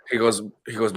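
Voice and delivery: normal talking voice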